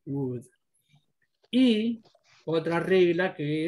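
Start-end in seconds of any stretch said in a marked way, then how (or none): 0.1-0.4 would said softly as wood; silent L. Shown as a demonstration. Teacher echoing the student's try, soft would with silent L. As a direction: softly model would without its L. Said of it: "la O y la L es muda".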